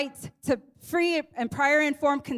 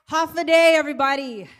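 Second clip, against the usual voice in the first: loud greeting; 3 semitones higher, 7 dB louder than usual. Real, not acted. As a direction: rouse the crowd with a big Håfa adai. Greeting crowd, repeated louder.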